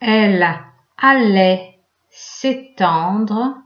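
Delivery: slow, word by word with pauses; dictating. Shown as a demonstration. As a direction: dictate slowly, one chunk at a time. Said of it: "Alors, je commence à dicter".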